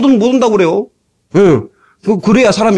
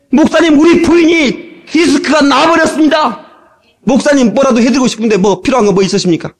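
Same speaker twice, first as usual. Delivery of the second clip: high-pitched, urgent congregant; raised, eager voice easing down; grateful phone caller; 8 semitones higher than usual. Retold or imitated. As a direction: imitate an earnest caller addressing the pastor. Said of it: "전화가 오는 거예요".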